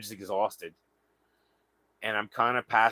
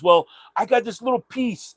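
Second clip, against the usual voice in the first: much higher, louder, proud offer; stranger's voice; 11 semitones higher, 7 dB louder than usual. Retold or imitated. Stranger pulls out a tiny bud, high proud voice. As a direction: up high and loud, showing off. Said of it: "he goes".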